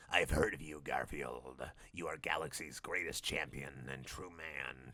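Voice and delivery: low, slow, soft mysterious voice; mysterious whisper. Acted. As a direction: murmur it low like a stranger in the shadows. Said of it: "with mysterious whisper".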